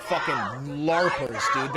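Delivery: low slow jeering yell; scornful shout. Real, not acted. Streamer yelling at the masked officers, scornful shout. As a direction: spit it out with contempt.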